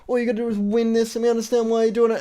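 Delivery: rising, earnest coach pep talk, fast at the end; Rocky pep-talking Creed in the corner, mock earnest. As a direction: hype him up from the corner, lightly. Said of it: "Rocky's like".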